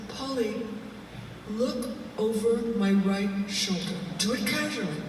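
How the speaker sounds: soft, casual undertone to a friend; discreet instruction. Retold or imitated. Secretly pointing out a man to friend.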